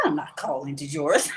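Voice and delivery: refusing with a laugh; chuckling no; laughing refusal. Retold or imitated. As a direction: laugh off God's order. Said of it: "I said".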